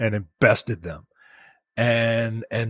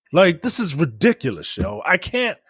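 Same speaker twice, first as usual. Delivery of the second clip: normal talking voice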